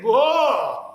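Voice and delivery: bitter laugh. Acted. After outburst, bitter laugh.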